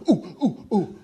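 short, high hoots; eager "pick me" oohs. Retold or imitated. Member desperate to be called on at a meeting, eager oohs. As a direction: imitate a pupil begging to be called on. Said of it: "he probably saw me going".

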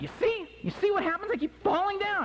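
exasperated high-pitched protest; angry, frustrated. Retold or imitated. Skier to girlfriend after ten falls, angry and frustrated. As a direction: complain in a high, worked-up voice. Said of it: "and I'm angry, and I'm wet, frustrated".

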